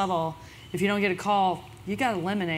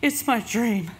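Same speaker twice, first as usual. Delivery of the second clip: Katie voice; 2 semitones higher, 3 dB louder, slower than usual. Retold or imitated. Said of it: "in her Katie voice that I just can't resist".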